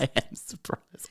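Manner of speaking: put-on slow, whispered Polish-accent echo. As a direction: softly copy a Polish accent, amused. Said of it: "this is where I do the accent".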